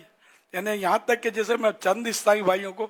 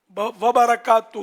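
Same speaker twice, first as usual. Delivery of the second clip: slow, louder "وبرکاتہ" drawn out; emphasized ending; 3 semitones higher, 7 dB louder, much slower than usual. Shown as a demonstration. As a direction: stretch "وبرکاتہ" with weight. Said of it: "ساتھ میں بڑھا دیا". ("وبرکاتہ" پر زور دے کر آہستہ)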